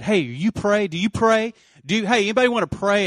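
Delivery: high, loud, urgent calling to a crowd. Real, not acted. Calling strangers to pray.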